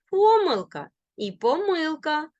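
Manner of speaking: помилка stressed first on по, then on мил; double stress. Shown as a demonstration. Teacher showing both allowed stresses, double stress. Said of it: "можуть наголошуватися бінарно".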